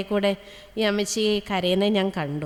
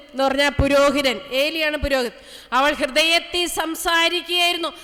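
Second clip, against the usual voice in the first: fervent preaching; 9 semitones higher than usual. Acted. Sister preaching to the congregation about tearful prayer, fervent and high.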